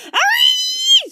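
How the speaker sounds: put-on very high, loud girl's squeal; long piercing squeal of disgust; shrieking squeal. A narrator's reading. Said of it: "squealed Sally".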